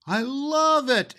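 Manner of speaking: put-on high, slow, gushing child's voice; a little girl hugging her gift; high drawn-out child's I love it; adoring little child. Mary loves the doll.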